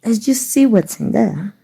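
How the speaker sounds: ordinary talk, nothing marked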